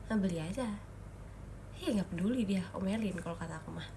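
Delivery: usual speaking voice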